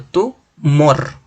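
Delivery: "tu mor" slowly split, weight on "mor"; syllable-by-syllable "tu mor", final "mor" strongest; stress on last syllable. Shown as a demonstration. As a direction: pause after "tu", then punch "mor". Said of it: "la mayor fuerza de voz está en la última sílaba".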